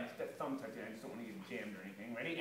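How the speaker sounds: ordinary talk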